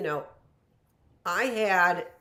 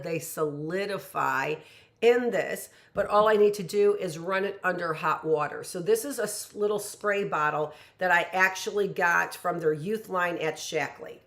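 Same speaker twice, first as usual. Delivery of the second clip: ordinary talk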